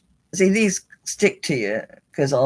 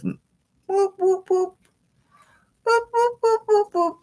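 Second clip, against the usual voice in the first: very high, sing-song whoop whoop while moving the toy turtle; squeaky playful toy noises, pitched way up; slower than usual. Pretend. Making the turtle move.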